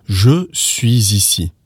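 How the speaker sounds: slow "Je suis ici", each word full, with a z linking "suis" to "ici"; careful with liaison. Shown as a demonstration. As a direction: textbook "Je suis ici", pronounced "zhuh swee-zee-see". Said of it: "the S to do the liaison".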